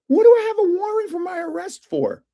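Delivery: replaying his own shocked question; incredulous retold disbelief. To the police chief on the phone, incredulous high disbelief.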